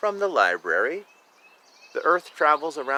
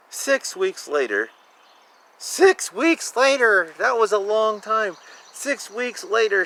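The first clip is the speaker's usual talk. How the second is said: story narration; 3 semitones higher, 4 dB louder than usual. A narrator's reading. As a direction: read it warmly to a child. Reading picture book aloud.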